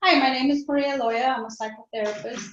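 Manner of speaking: ordinary talk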